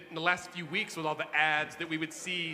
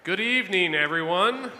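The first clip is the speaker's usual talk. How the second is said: loud, drawn-out show opener, pitched up; calling to the crowd; 3 semitones higher, 12 dB louder, slower than usual. Real, not acted. Host greets the election night crowd, calling out loudly. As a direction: project big to open the show.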